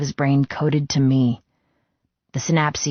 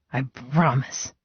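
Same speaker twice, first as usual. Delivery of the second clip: pained, tender; 3 dB quieter, slower than usual. A narrator's reading. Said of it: "His voice haunted with pain".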